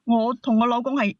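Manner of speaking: ordinary delivery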